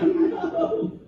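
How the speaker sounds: high, startled no; panicked little no; caught-out no. Retold or imitated. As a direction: blurt it out, caught red-handed. Caught by ranger.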